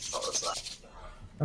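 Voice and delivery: unvoiced slow whispered question. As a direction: breathe the question fearfully. Whispered alarm.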